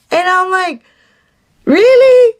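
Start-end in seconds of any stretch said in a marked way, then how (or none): 1.7-2.3 incredulous. Retold or imitated. Mock reaction to date's claim.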